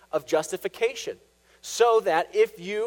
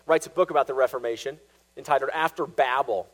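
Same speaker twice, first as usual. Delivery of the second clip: ordinary talk, nothing marked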